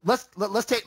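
ordinary talk

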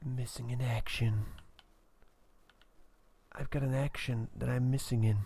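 a friend asks what that whisper was about; mock whisper. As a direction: hush it like a secret. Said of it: "What's with that, like, whisper?".

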